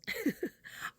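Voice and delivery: breathy chuckle; quick unvoiced laugh; short airy laugh. Real, not acted. Laugh after joke about bodies.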